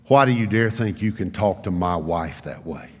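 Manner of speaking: low, controlled retold rebuke; a hint of quiet, stern dad; serious father. Father confronting his son about his wife, quietly serious. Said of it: "then I knew it was serious".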